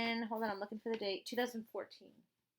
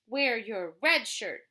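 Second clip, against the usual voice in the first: slow, loud, bossy friend; 9 dB louder than usual. Acted. Parent reading Marty's line, loud and slow. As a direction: boom it out like an eager pal.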